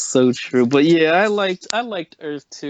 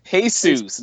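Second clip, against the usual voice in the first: ordinary talk, nothing marked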